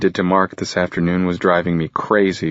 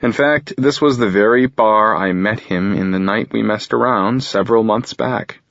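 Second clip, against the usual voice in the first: plain reading voice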